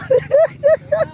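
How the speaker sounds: high-pitched burst of laughter. Real, not acted. Laughs after a remark.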